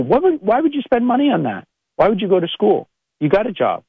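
mock the friend who shoots you down; mocking naysayer. Mimicking a dismissive naysayer.

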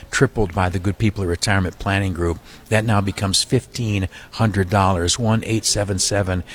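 ordinary talk

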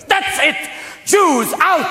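very high-pitched exasperated order; fed-up shout. Acted. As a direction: throw them out at a high yell. Pharaoh's outburst after firstborn dies.

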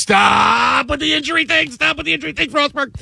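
high, strained mock yell; exasperated outburst. Fed-up Knicks fan pleading about injuries, high mock yell. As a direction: wail it high, stretching out the first word.

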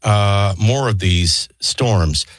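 low-pitched radio newsreader; news read. Acted. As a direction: deliver the headlines in a low, measured register. Reads the news headlines.

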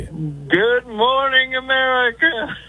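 slow, high, drawn-out announcer hello; morning show greeting. Pretend. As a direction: announce it like a TV host. Caller opens with TV-show greeting.

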